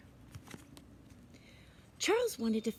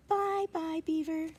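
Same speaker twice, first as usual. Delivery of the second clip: put-on high, friendly kid farewell; high cheerful goodbye; 6 semitones higher, slower than usual. A narrator's reading. Charles says goodbye to the squirrel, high and cheerful. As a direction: wave it off brightly up high.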